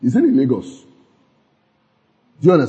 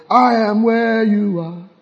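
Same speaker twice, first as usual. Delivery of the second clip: slow confident prayer; 7 semitones higher, slower than usual. Retold or imitated. Corrected prayer quoted.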